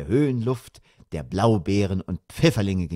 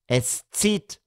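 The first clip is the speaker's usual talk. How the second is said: higher-pitched mild grumble; grumbling mother; 7 semitones higher than usual. A narrator's reading. Mother grumbling about the open window, irritated. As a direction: complain about the draught. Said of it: "brummte die Mutter".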